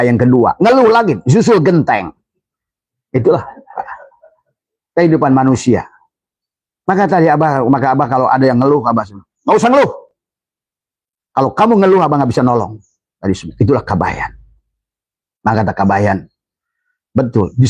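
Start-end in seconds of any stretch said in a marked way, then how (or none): 0.6-1.6 sharp high exclamation; emphatic cry. Real, not acted. Emphatic sermon point. 9.5-12.8 high, raised telling-off to a complainer; stern teacher scolding, raised; stern advice. Retold or imitated. Preacher quoting his own advice.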